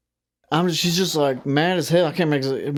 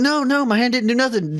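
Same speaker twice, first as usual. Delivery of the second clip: higher, protesting imitation; mock denial; 7 semitones higher than usual. Customer caught breaking something protests to clerk, mock denial. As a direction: imitate a customer pleading innocence. Voices customer denying the deed.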